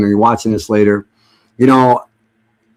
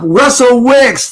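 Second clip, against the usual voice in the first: drawn-out name call; 12 semitones higher, much slower than usual. Real, not acted. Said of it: "I'm gonna salute you guys".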